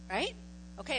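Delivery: ordinary talk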